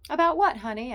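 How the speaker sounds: high, bright-voiced nosy wife; chatty Estelle. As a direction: gush at Sylvia like a meddling friend.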